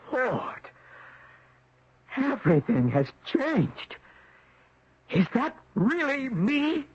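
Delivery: high, hushed, slowed gasp of disbelief; stunned disbelief. Acted. Man seeing a new body in the mirror, stunned and hushed. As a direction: stare at a stranger's face in the mirror.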